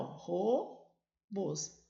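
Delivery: robôs said slowly, split into ro-bôs; slow syllables. Shown as a demonstration. Teacher showing students where robôs splits. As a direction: stretch robôs into two slow beats. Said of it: "tá vendo que a sílaba tônica separa aqui".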